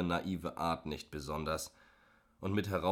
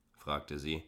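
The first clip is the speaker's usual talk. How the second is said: ordinary delivery, nothing marked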